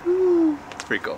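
put-on high, delighted toddler "ooh"; baby voice. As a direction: imitate the baby's tiny wow. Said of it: "and he's like".

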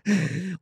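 high, light giggle; amused laugh. Real, not acted. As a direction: laugh at your own playful remark. Laughs at likes in timeout.

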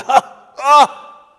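two high, loud "ha" gasps; trembling demon's cries. Acted. Demon trembling before Jesus, two fearful "ha" cries. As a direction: burst out in startled fear. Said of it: "is trembling here".

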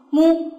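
mu cut short with rounded lips; short syllable. Shown as a demonstration. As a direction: say a quick, short mu. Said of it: "短音阶合口".

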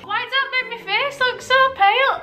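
very high, whiny outcry; high-pitched complaint. Real, not acted. Complaining to camera about the light, high-pitched. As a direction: wail it up high. Mock outrage at her lighting.

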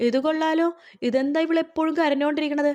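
high-pitched mock indignation; acted scolding. Mother-in-law finds Preethi crying again, acted scolding.

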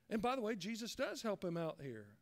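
usual speaking voice

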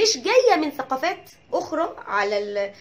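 usual speaking voice

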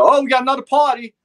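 high, loud mock holler; imitated excited call-out. Firefighter mimics crew finding another fire, excited holler.

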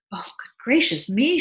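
high, loud exclamation; alarmed cry. A narrator's reading. Wife wakes very late, startled cry. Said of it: "she cried".

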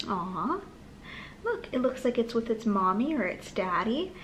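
ordinary delivery, nothing marked

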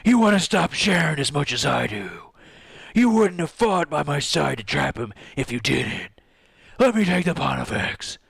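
put-on heroic character voice; earnest pleading hero. Hero offering to take his friend's burden, earnest plea. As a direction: plead like a resolute story swordsman.

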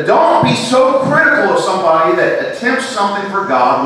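high, loud pulpit delivery; full-force sermon voice; fervent preaching. Acted. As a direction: exhort the congregation.

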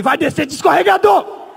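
high, loud mock telling-off; scolding shout. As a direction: bellow it like a furious order. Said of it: "Dei uma bronca do caralho". (bronca aos gritos, encenada)

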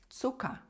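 Цука with the final R softened to an open "a"; German Zucker, ending in "a"; vocalized final R. Shown as a demonstration. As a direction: end Цука on a vowel, no R. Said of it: "Р в кінці ми не читаємо як Р".

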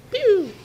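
high pew firework sound; fireworks noise. Retold or imitated. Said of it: "How does fireworks sound like?".

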